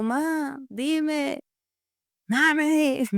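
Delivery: high call across rooms to mom. Retold or imitated. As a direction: call out to mom from the next room. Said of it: "le grito a mi mami". (llamando a mamá desde otra habitación, voz aguda)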